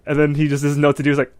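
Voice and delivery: usual speaking voice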